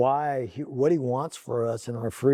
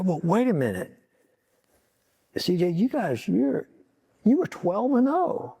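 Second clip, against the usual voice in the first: higher, urgent and insistent, reminding a son of his glory days; earnest pleading; 5 semitones higher than usual. Retold or imitated. Father urging son CJ to remember his best season, urgent and high. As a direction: press it on him, eager and earnest.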